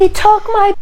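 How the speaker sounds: high-pitched acted scream of anguish; screamed grief. Mother seeing old friends thirty years later, screaming. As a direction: scream it out, pitch leaping far up. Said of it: "and screamed".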